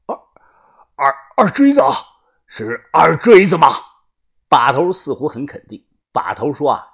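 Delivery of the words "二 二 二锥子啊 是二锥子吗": stammering, rising disbelief; bewildered. A narrator's reading. Old master learns who betrayed him, bewildered stammer. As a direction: stumble over the name, then question it louder. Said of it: "迷茫地说道".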